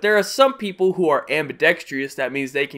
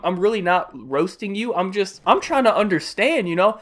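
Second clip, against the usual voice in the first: ordinary delivery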